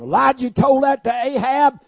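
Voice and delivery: a fiery preacher shouting the story; preaching at full force. Acted. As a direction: belt it out to the congregation. Preaching peak.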